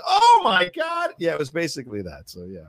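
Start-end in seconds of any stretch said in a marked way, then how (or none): 0.1-1.1 overwhelmed. Retold or imitated. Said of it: "overwhelmed exclamation".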